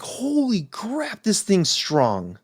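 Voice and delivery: drawn-out, high start falling away; retold amazement, slowly stretched out; stunned reaction. Reacts to a strong drink.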